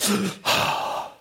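big acted gasp of air; composing gasp. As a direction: gasp to pull yourself together after crying. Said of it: "washes his face and goes".

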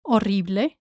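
Horrible as o-RREE-bleh; silent H. Shown as a demonstration. Teacher models for learners, Horrible without the H. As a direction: drop the H from Horrible, Spanish style. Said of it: "the H is silent".

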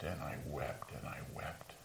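whispered, dropping low and soft; fading whisper. Acted. Peter, grieving his denial, whispers softly. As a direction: let the words die away into a whisper.

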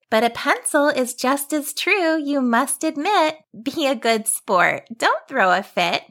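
put-on high teasing girl; playful girl rhyming back; young girl voice.

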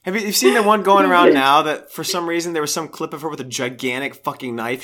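normal talking voice

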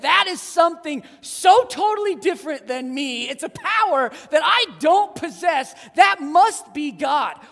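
loud, building into a shout of wonder; awed shout. Pretend. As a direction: cry out before a blinding light. Imagined onlooker before blazing light.

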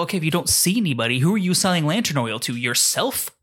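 normal talking voice

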